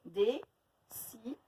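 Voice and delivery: Dessiner split slowly into beats; slow syllables. Shown as a demonstration. Teacher counting syllables for pupils, slow. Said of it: "Trois syllabes".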